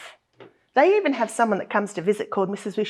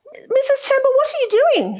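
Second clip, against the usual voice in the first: much higher, louder mock surprise; 4 dB louder than usual. Teacher asking her colleague about the puppet, mock surprised. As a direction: ham up the surprise for the kids.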